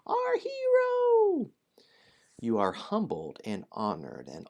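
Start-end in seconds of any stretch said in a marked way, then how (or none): 0.1-1.5 drawn-out squeaky gnome cheer; high-pitched squeals. Acted. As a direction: squeal it slowly like a crowd of tiny fans. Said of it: "shouting together in high-pitched squeals".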